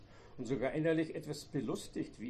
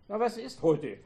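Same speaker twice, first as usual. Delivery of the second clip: pushing the question louder and higher; 7 semitones higher, 8 dB louder than usual. A narrator's reading. Husband repeats his question louder at his wife. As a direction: raise your voice, demanding an answer. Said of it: "fragte er lauter werden".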